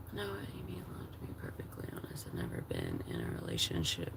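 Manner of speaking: whispered confession. Real, not acted. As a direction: breathe the words out barely voiced. Reading a comment softly aloud.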